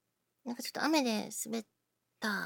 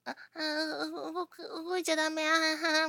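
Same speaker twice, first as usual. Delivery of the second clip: acted pained whimpers; 6 semitones higher, 4 dB louder than usual. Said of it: "ちょっと動くと痛いから".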